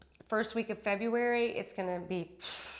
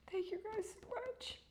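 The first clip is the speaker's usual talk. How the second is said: tearful; 11 semitones higher, 8 dB quieter than usual. Real, not acted. Crying thanks.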